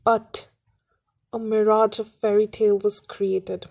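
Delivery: deliberate, phrase-by-phrase verse reading; poem recitation. Acted. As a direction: recite it firmly with pauses between short phrases. Recites poem after introducing it.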